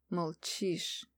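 low, hushed, resigned; doomed resignation. A narrator's reading. Prince gives up on her silence, resigned and quiet. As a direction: let it drop, soft and defeated. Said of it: "обречённо заключил наследник империи".

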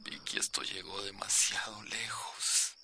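hushed whisper. Acted. Ex calls at 2 a.m.

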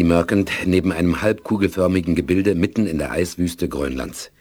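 ordinary talk

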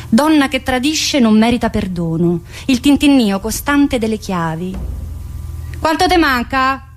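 normal talking voice

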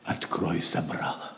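soft breathless whisper; hushed whisper. Acted. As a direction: whisper it in awe. Просит Ричарда тихо. (шёпотом, затаив дыхание)

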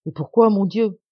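loud, dismayed question; distressed protest. A narrator's reading. Sister demands her brother's reason, distressed protest. Sister reacts to his refusal.

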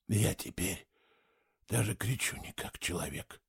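very soft, low-volume whispered line. A narrator's reading. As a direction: whisper it to yourself, barely any voice. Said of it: "прошептал он". (тихий шёпот)

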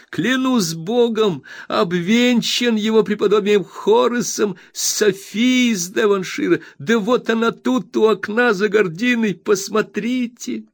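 high, bright, eager friend's voice; excited Traddles. A narrator's reading. Traddles reassuring his dear Copperfield, high and overjoyed. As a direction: bubble over with glad welcome.

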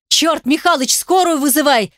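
panicked yell for an ambulance; shouting. A narrator's reading. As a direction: shout it out in alarm. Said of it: "закричал я".

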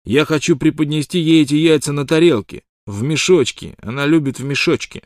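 light up with eagerness; suddenly excited. A narrator's reading. Wild Water explains the eggs are for her, excited. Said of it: "внезапно возбуждаясь".

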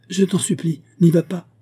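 high, urgent begging; alarmed plea. A narrator's reading. Ladov holding his fiancée back from the cliff, alarmed plea.

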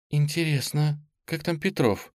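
high, casual-sounding wondering; feigned indifference. A narrator's reading. Nastya wondering about missing Petrov, trying to sound indifferent. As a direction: try to sound as if it hardly matters. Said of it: "произнести эту фразу с безразличием".

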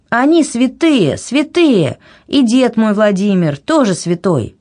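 high, emphatic protest; heated insistence. A narrator's reading. Anna defending her holy relatives to the king, heated. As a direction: insist hotly, pitch well up.

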